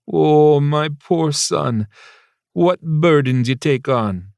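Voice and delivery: put-on slow, high theatrical sympathy in Jamaican accent; drawn-out mock lament; mock pity. Mother mock-pities son, teasing.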